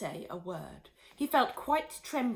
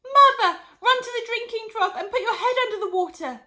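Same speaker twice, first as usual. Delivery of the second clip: panicked crying plea; 11 dB louder than usual. Acted. Panicked daughter orders mother.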